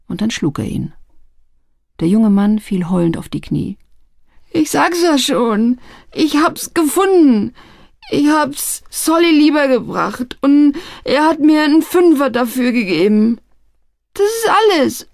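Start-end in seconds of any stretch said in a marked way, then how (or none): 4.5-15.1 whining. Acted. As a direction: wail it out, begging them to stop. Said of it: "jammerte er". (hoch jammernd, weinerlich gestehend)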